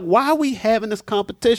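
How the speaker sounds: high-pitched, incredulous question; incredulous retort. Retold or imitated. Reacting to someone comparing oppressions, incredulous.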